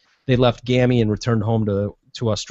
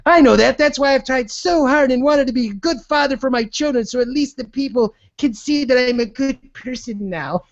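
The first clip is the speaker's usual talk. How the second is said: high, self-pitying mock tycoon; Don Sterling voice; 10 semitones higher than usual. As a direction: plead your innocence, hammed up. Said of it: "This is Don Sterling giving this interview".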